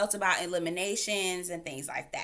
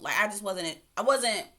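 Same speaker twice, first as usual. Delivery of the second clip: ordinary talk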